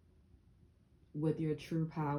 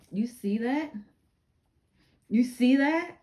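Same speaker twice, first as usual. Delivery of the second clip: rising higher and louder, thrilled to point it out; you see that, getting more excited each time; excited showing off; 8 semitones higher, 11 dB louder than usual. Real, not acted. Showing off her plant's new growth.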